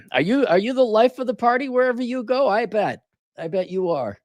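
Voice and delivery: high-pitched mock wonder; sarcastic admiration. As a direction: gush in fake admiration. Mocks the floss giver.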